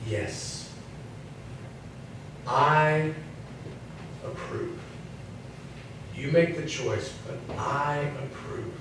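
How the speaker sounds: hushed, gentle yes from God; quiet voice of God. Retold or imitated. God quietly approves the preacher's choice to marry, hushed. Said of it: "a nice, quiet".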